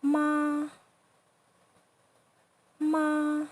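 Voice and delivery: "Ma" held high and flat; long, steady high "Ma"; first tone. Shown as a demonstration. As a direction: say "Ma, ma" on one level high pitch. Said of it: "Check out when we say mother".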